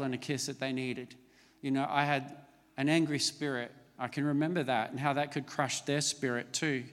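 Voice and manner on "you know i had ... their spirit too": ordinary talk, nothing marked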